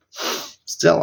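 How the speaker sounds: ordinary delivery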